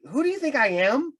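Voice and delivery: high-pitched, bewildered asking; baffled question. Retold or imitated. Finally questions the mistaken fans.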